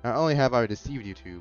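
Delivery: ordinary talk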